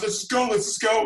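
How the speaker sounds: urgent getaway call. Retold or imitated. Two friends fleeing with the stolen pumpkin, urgent. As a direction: urge them to flee, retold with excitement.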